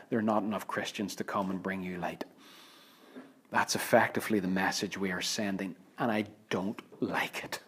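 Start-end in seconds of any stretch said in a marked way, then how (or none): 0.1-2.2 hushed whisper. Pretend. Quoted message to locked-out youth. 6.0-7.6 whispered aside. Real, not acted. Preacher's own sad aside.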